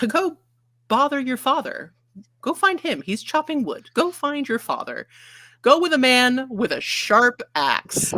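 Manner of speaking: put-on high, exasperated mother sending kid off; harassed mother. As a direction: push the kid off to his father. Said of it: "as any harassed mother would sound".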